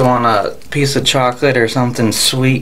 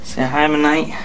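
higher sing-song voice for the cat; pet voice; 4 semitones higher than usual. Real, not acted. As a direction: coo it at the cat. Introducing and greeting the cat.

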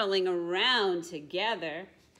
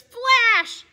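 very high, loud burst; flash sound; 15 dB louder than usual. A narrator's reading. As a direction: pop it like a camera flash. Camera sound effect.

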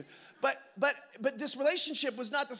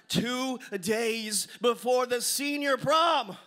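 loud, drawn-out proclamation; big slow build-up to the punchline; dramatic emphasis; 7 dB louder, much slower than usual. Real, not acted. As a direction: boom it slowly, word by word, to the crowd. Dramatic repeat for the audience.

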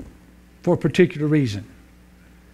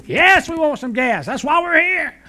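much higher wife voice; wife answering the attendant, much higher; hard-of-hearing wife; 9 semitones higher than usual. Acted. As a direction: pitch her up into the wife's voice.

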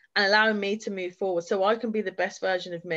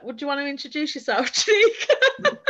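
much higher, louder, breaking into laughter; nervous laugh swallowing the name; laughing through a quote; 6 semitones higher, 5 dB louder than usual. Real, not acted. Recalling the table's awkward question, laughing nervously. Retelling tense meeting, laughing.

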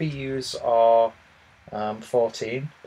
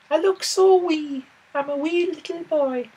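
mock Irish-Scottish accent. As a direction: squeak it out in a jokey Irish-Scottish lilt. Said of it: "like a mixture of Irish and Scottish".

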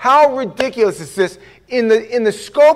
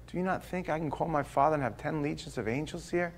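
gentle Lord; 4 semitones lower, 16 dB quieter than usual. Retold or imitated.

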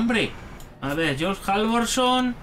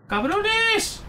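high yelped curse; startled curse; 13 semitones higher than usual. Real, not acted. Player startled by a scare yelps a curse. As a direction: yelp it out, caught off guard. Jump scare while searching.

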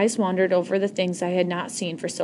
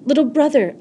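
calling out loud and high across the camp; 7 semitones higher, 6 dB louder than usual. Acted. Girl calls out to her little brother as she runs. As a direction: call to him from a distance, voice raised. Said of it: "I called when I was close enough".

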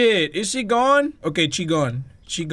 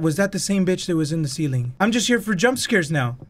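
ordinary talk, nothing marked

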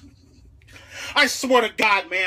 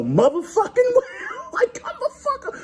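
laughing shout; 3 dB louder than usual. Acted.

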